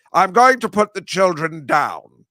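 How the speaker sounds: slow creepy British film villain; Jeremy Irons imitation. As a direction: purr it out slowly like a menacing aristocrat. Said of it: "my Jeremy Irons imitation".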